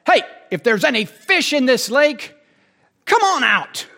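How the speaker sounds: loud, high holler out over the water; yelling at the lake. Acted. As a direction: call out to fish as if they could hear. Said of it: "he's yelling at the lake".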